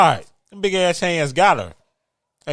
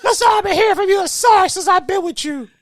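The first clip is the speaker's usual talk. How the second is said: high, whiny character voice; mock character voice; 3 dB louder than usual. A co-host voices the bickering couple, high and whiny. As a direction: play the aggrieved partner, pitched high.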